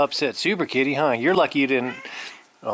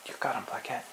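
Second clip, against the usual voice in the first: whisper; 23 dB quieter, faster than usual. Real, not acted. Egging cat on during play.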